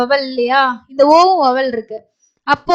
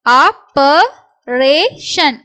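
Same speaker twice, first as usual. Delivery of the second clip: slow syllables with gaps; much slower than usual. Shown as a demonstration. Teacher sounds out the next word, syllable by syllable. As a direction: break "operation" into separate beats.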